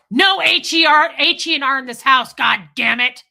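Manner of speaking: loud, high furious yelling; a father yelling in his child's face; angry dad. Retold or imitated. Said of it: "My dad used to clap in my face and go".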